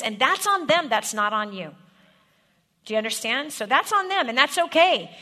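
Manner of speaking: loud, high and driving sermon voice; rising preacher's fervour; full-force preaching. Acted. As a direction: preach it to the back row.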